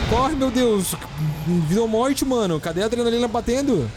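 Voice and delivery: high frantic urging; frantic shouting. Real, not acted. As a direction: yell in a panic. Running for life in game.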